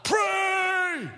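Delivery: high, drawn-out preacher's yell; one ringing, high-pitched cry imitating a revival preacher; yelled command. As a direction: yell it out like a call across a hall. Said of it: "he would yell out".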